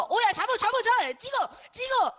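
high, loud frantic calls; excited shouting. Real, not acted. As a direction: yell orders to grab it. Calling teammates to attack.

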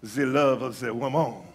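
put-on low, amorous French lover accent; low Frenchman savouring romance; mock French accent. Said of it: "I'm always French when I".

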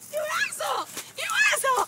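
high, loud teasing shout; playful yell. Real, not acted. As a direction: yell it after them, half laughing. Yelling playfully at someone.

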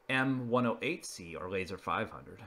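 usual speaking voice

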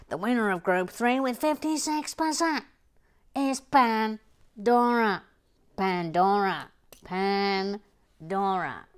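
loud announcer voice, building suspense. Acted. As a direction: present it like a game show host.

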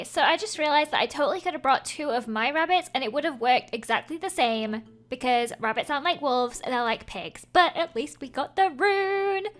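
ordinary delivery

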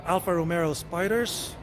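ordinary talk, nothing marked

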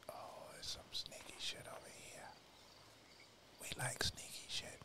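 hushed delighted whisper. Real, not acted. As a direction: whisper like a sneak. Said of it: "I don't know why I'm whispering".

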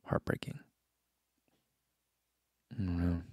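breathy whispered last word. Real, not acted. Musing on ending a streak, whispering the word. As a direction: let the word fade to a whisper. Trailing whisper on a sad thought.

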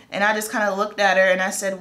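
ordinary talk

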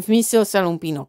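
plain reading voice

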